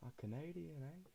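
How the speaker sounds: mock Canadian twang on eh; bad Canadian accent. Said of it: "That was a horrible accent".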